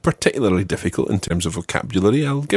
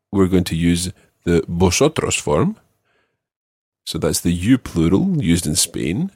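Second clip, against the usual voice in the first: ordinary talk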